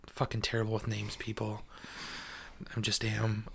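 breathy under-the-breath whisper; whispered aside. Real, not acted. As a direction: mutter it to yourself, self-mocking. Self-deprecating aside about names.

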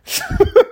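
short high squeal of a laugh. Real, not acted. Laugh after the quote.

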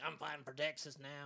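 imitated Texas accent; mock Texan. Joking about Texans staying to fight, mock Texas accent. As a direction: play a Texan loyalist. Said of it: "some people just gonna stay".

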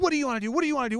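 high, eager questioning voice; eager yes man. Retold or imitated. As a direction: pester cheerfully like an overexcited helper. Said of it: "He's like".

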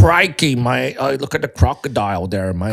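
exclaimed mock-Aussie impression; hammed-up Australian, punched at the start; Australian accent. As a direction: play an Australian croc spotter. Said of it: "try to do an Australian accent".

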